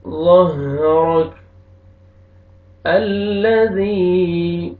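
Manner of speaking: drawn-out chanted tajweed recitation; slow Quran recitation. Shown as a demonstration. Teacher recites the verse slowly for students. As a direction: intone each word slowly like a reciter.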